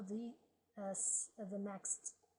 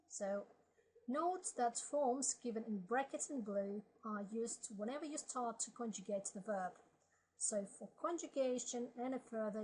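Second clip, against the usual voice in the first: normal talking voice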